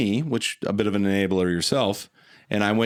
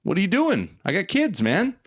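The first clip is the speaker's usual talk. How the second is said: alarmed refusal; 7 semitones higher than usual. Retold or imitated. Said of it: "I'm like".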